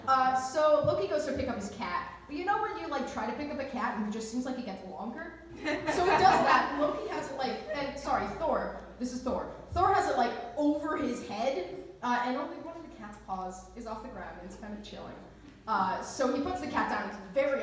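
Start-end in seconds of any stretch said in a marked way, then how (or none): none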